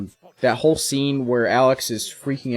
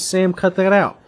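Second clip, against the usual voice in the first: ordinary talk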